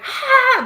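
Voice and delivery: a high, strangled "Ah" held back; stifled mock scream. Mimicking a teacher meme's muffled Sunday scream. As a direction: scream without letting it out loud. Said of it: "she's screaming, but she's not screaming".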